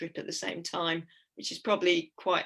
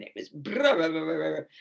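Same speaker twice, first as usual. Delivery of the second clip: shivering brr. Retold or imitated. Describing the Scottish cold.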